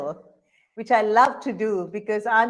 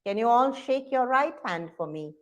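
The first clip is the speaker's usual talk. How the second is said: ordinary delivery, nothing marked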